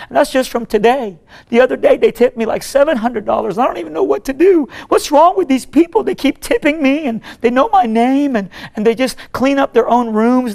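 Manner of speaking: high, loud, overwhelmed cleaning lady; imitated emotional outburst. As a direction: pour it out as an overwrought woman, acted. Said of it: "I'm sorry to be emotional".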